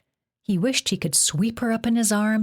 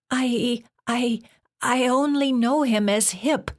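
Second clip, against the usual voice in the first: put-on higher, slow frightened girl; Jen's voice; 7 semitones higher than usual. A narrator's reading. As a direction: answer timidly in a girl's voice. Jen stammering in fear.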